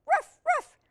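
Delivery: two very high, loud puppy barks. Acted. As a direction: yap twice like an excited puppy.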